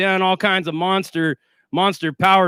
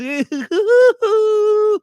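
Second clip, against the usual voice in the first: high, drawn-out mocking hoot; mocking laugh; 4 dB louder than usual. Real, not acted. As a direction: laugh in their faces, high and long. Mocking haters with a cackle.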